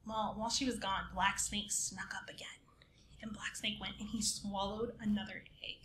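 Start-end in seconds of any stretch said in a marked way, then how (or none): 1.1-5.8 soft, hushed whisper as the snake creeps in; sneaky whisper. A narrator's reading.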